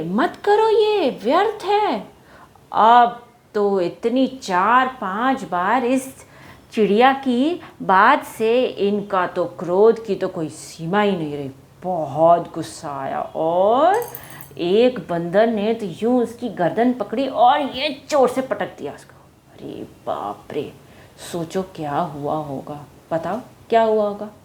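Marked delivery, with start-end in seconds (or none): 0.0-2.1 high, slow, firm scolding; bit angry. Acted. Bird scolds the monkeys a bit angrily, high and slow. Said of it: "थोड़ा सा गुस्से में कहा". 19.5-20.7 low, hushed "oh my god"; shocked gasp. Acted. Storyteller reacts to the bird's fall, hushed shock. Shock at bird being thrown.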